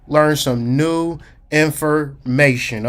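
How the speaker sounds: "learn some new information" slow, broken into pieces; slowed for emphasis. Real, not acted. Speaker urging listeners to slow down, slow and measured. Repeats the point, urging slowing down.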